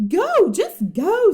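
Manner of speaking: high, loud eager push; impatient urging. Acted. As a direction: urge him on, bursting to go. Annie pushing Jack impatiently.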